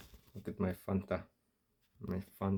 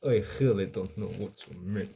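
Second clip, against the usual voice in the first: Russian accent. Shown as a demonstration. Retrying the line for chat so it sounds Russian, restrained Russian accent. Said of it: "speak English in a Russian accent".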